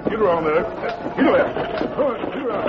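high, frantic shouts at the team; urging horses. Acted. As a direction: haul them round in a hurry. Turning ambulance in panic.

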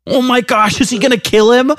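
high, hammed-up gasp of dread; mock alarm. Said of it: "and you're just like".

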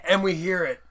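normal talking voice

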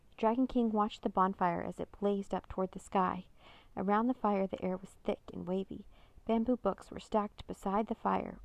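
usual speaking voice

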